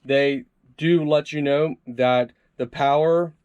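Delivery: ordinary talk, nothing marked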